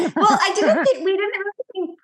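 high, loud, talking through laughter; laughing. Real, not acted. Joking with co-host about Mom's funeral song, laughing. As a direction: laugh it out while getting the words across.

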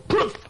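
a high popping "poof" like a blown fuse; mock explosion "poof". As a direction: burst it out as father's temper going off. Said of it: "Father is apt to go".

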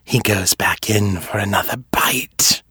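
whispered threat; menacing whisper. Acted. Fisher threatening Seffi in a whisper. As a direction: breathe the threat right into her ear.

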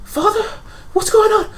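put-on high-pitched little boy voice; young boy. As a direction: play a child asking his father. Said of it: "like, a young boy".

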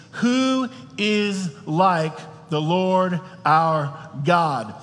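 each word drawn out slowly and weighty; slow solemn reading. Acted. Preacher reading the psalm's question slowly to the congregation. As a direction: read it slowly, letting it land. Preacher reads the key verse.

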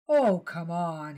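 drawn-out, slow teasing exasperation; mock impatience. Husband teasing his wife over forgotten shopping, mock impatient. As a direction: act fed up, but only in jest. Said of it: "he said with mock impatience".

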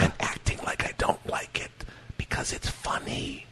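whispered aside; whispering. Real, not acted. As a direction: whisper it under your breath. Aside explaining the joke.

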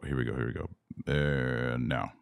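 ordinary talk, nothing marked

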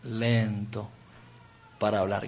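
lento para hablar, stretched with a pause, low and soft; slow and soft. Shown as a demonstration. Preacher repeating the exercise with the crowd, slow and hushed. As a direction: drag it out quietly. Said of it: "cerrar muy lento".

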